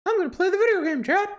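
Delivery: high squeaky cartoon mouse; Mickey Mouse voice.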